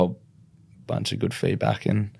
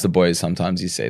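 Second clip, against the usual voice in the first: ordinary talk, nothing marked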